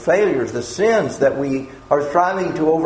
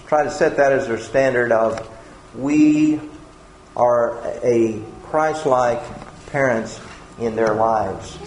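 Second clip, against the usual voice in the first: normal talking voice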